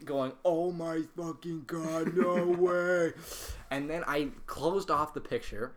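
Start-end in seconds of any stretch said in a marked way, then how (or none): 0.4-3.1 mock scream. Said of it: "Evan starts screaming".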